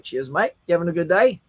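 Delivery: imitated Australian accent, each phrase ending on a rise; Australian upward lilt. Shown as a demonstration. Host imitating Australians' chat, Australian accent with rising endings. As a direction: lift the endings like a friendly Australian. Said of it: "everything is up like that".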